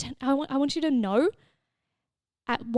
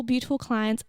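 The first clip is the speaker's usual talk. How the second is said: normal talking voice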